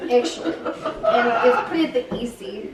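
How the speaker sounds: Russian-accented English. Shown as a demonstration. Child tells how she learned it, Russian accent. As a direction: explain it all in a Russian accent. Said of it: "the Russian accent".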